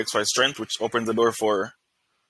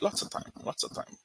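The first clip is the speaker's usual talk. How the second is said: whispered; 12 dB quieter than usual. Real, not acted. Unvoiced repeat to himself.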